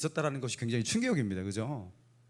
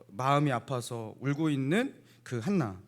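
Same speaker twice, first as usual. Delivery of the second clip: normal talking voice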